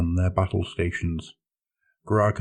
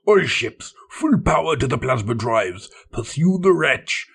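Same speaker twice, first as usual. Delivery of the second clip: shouted orders; 6 semitones higher than usual. Acted. Captain ordering the pursuit across the bridge, shouting. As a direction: roar the orders to every ship. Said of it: "he shouted".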